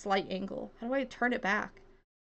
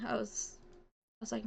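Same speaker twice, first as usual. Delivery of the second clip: ordinary talk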